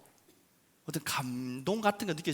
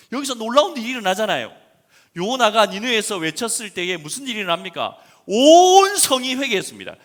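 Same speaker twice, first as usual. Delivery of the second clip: loud, high fervent preaching; preaching at full force; 7 semitones higher, 14 dB louder than usual. Acted. Pastor telling the Nineveh revival, fervent loud preaching. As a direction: thrill them with the revival.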